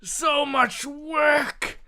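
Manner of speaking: high, wailing hammed-up dying cry; mock-tragic high cry; dying lament. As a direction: wail it as he dies. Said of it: "he says".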